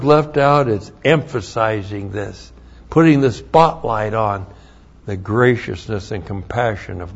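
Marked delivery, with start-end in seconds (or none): none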